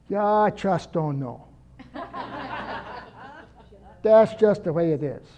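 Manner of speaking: lightly imitating grandma Alice's slow German accent with a laugh. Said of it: "She had this German accent".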